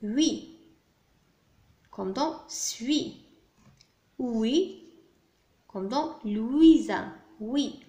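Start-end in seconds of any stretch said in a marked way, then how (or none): 1.9-3.2 suis stretched very slowly to show the ui sound; teacher slowly sounding out suis for pupils; slow sound demo. Shown as a demonstration. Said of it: "Ici, on a U I". 5.7-7.1 Louisa drawn out slowly to show the ui sound; teacher stretching Louisa to show the ui sound; slow sound demo. Shown as a demonstration. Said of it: "Ici, on a U I".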